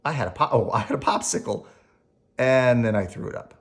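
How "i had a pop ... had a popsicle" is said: ordinary delivery, nothing marked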